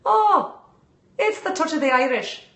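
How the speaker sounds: high, hammed-up Irish lilt; stagey leprechaun-style Irish brogue; Irish accent. Shown as a demonstration. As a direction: leap up high into a playful mock-Irish accent. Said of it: "an Irish accent".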